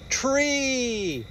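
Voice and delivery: plain reading voice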